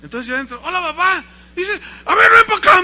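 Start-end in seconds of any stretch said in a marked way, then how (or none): 0.6-1.2 put-on high, loud child's hello; acted cheeky kid; little boy greeting. Boy greeting his father. 2.1-2.8 very high, loud shouted summons; hammed-up angry dad yell; furious father. Angry father summoning smoking son.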